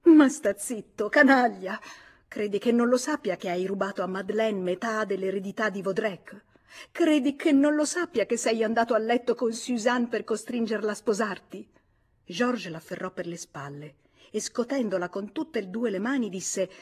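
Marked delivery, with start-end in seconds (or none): 0.0-11.7 seething accusation, loud burst on the first words. A narrator's reading. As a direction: lash out trembling with indignation, then press the charge. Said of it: "Lei mormorò fremente". (fremente di rabbia, accusatoria)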